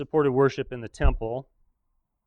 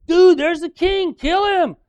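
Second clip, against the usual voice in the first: high, loud, slow soldier yell; battle shout; 13 dB louder, slower than usual. Retold or imitated. Aram soldiers spotting the king in battle, high loud yell.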